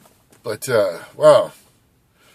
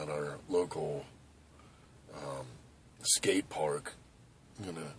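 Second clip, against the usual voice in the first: ordinary delivery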